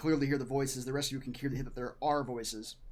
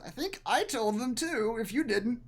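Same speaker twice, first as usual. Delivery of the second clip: voiced elf companion; 8 semitones higher than usual. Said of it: "he says".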